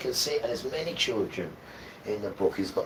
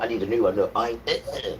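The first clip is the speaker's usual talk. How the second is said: usual speaking voice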